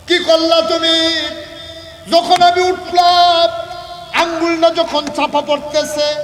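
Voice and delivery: high, loud, drawn-out cries; full-force anguished preaching. Acted. Prophet reproaches wife for hiding her pain, anguished preaching cry. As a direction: wail it out slowly at full volume.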